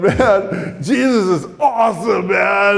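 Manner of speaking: loud, high tearful outburst; hammed-up blubbering shout of joy; mock crying. Broken new convert to his teacher, mock crying. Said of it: "I'm crying, and I'm going".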